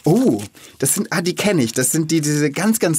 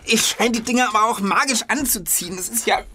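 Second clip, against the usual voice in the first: disgusted; 5 semitones higher than usual. Acted. Gum stuck on his shoe.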